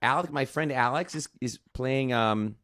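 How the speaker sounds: badly faked Wisconsin accent. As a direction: attempt a friend's Wisconsin accent. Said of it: "I can't do it".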